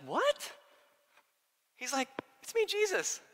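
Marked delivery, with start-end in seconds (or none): 0.0-0.5 high, squeaked disbelief; hammed-up baffled question; mock bewilderment. Acts out disciples' baffled reaction. 2.4-3.2 very high, playful mock reassurance; hammed-up innocent falsetto; Jesus. Comic Jesus announcing himself.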